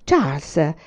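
mild exclamation, falling in pitch; exclaiming. A narrator's reading. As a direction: call out the name in mild reproof. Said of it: "esclamò mamma".

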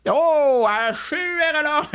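high, drawn-out spelling of H U R L A; H U R L A with a big rising "oh"; mock amazement. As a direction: spell H U R L A in exaggerated wonder.

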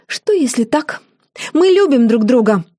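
put-on high, defiant young woman's outburst; proud, high-pitched declaration of love. A narrator's reading. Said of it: "Джорджина вдруг задрала подбородок".

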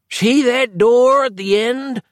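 put-on gasping old man, high and slow; breathless old person. A narrator's reading. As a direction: wheeze it out, winded old man. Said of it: "gasped the old man".

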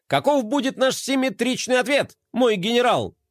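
high, brisk soldier report. A narrator's reading. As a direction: report eagerly to the general. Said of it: "бодро доложил он".

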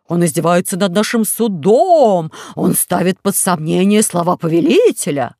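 loud indignant outcry; outraged notables. Acted. Courtiers denouncing Pashka, loud indignant cry. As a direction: shout with offended fury. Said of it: "закричали значительные лица".

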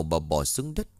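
plain reading voice

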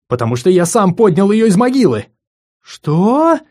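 loud, high, insistent cry; indignant shout. Acted. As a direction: yell it out defensively. Simon shouting his claim.

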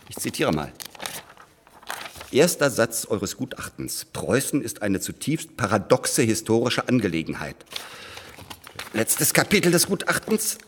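normal talking voice

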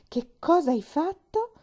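high, loud, slow disbelief; shocked. Acted. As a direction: stop dead and demand it. Maid stops short in shock.

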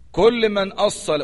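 usual speaking voice

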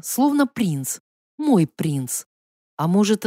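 ordinary delivery

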